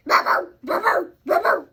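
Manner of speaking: loud yapping bark, bow bow; dog barking. Retold or imitated. Child shows the doggy's sound, loud barking. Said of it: "His sound is like this".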